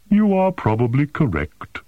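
ordinary delivery

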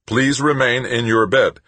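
automated warning from the bed monitor; synthesized computer voice. A narrator's reading. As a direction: announce it like a hospital computer. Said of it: "the computer repeated".

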